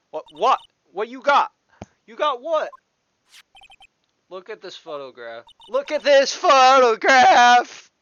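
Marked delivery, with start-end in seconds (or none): 0.1-2.7 high, loud burst of disbelief; startled witness. Acted. Witness answers lawyer's "got you", high and startled. 5.7-7.9 high, very loud, drawn-out bellow; over-the-top shout. Acted. Lawyer orders witness to look at the photo, hammed-up shout. Same line repeated as a shout.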